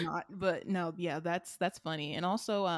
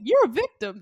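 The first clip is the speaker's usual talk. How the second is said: punchy, louder meme quote; meme quote delivery; 3 semitones higher, 8 dB louder than usual. Retold or imitated. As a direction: snap it back like the meme. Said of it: "someone in the reply is like".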